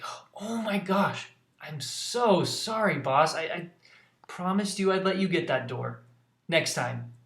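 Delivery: put-on eager young sidekick begging forgiveness; Nimona apologizing. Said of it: "She's like".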